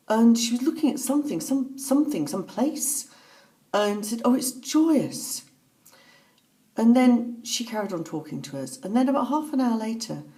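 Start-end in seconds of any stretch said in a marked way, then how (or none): 4.2-5.4 high, slow, marvelling quote; retold joy. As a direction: draw out the joy of someone seeing a vision. Retelling mother's joyful vision.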